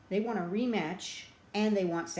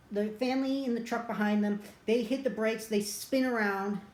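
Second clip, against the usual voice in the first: usual speaking voice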